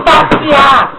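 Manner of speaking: Passear shouted high and loud; shouting. Real, not acted. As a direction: call out Passear at full voice. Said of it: "repeated takes of the word".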